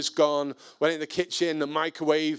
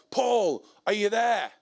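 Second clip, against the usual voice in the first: high-pitched call over a wall; retold shout over the wall; 7 semitones higher than usual. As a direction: call out to the neighbour, voice lifted. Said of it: "shouted over the wall".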